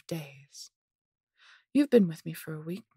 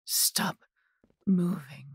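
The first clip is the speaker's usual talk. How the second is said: raised, broken-up order; louder command while trying to grab someone; exasperated command; 3 semitones higher, 5 dB louder, much slower than usual. Acted. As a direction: order a wriggling captive to hold still. Captor struggles to grab captive.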